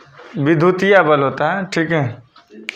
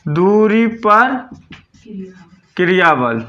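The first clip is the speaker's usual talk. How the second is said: slow dictation; slower than usual. Shown as a demonstration. Teacher dictating term for notes.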